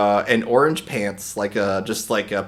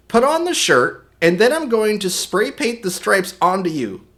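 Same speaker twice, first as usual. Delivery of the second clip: higher-pitched imitation of a mom giving orders; instructing mom; 8 semitones higher than usual. Mom telling little brother the costume plan, mock instructing. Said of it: "So my mom decided".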